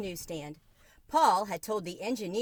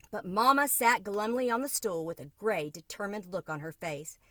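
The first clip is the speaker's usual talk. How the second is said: ordinary delivery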